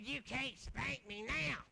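slow, drawn-out taunt imitating the wife; mock wife voice. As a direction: stretch it out as her playful dare. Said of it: "I'm gonna talk like that".

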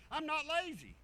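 usual speaking voice

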